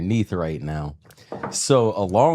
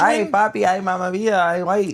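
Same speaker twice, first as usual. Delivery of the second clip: put-on camp up a Dominican lady's accent, much higher; 10 semitones higher than usual. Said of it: "That's Dominican".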